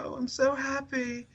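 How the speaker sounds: put-on very high, soft, contented British boy; tiny high-pitched British voice, softly pleased; British accent. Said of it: "he's another British guy".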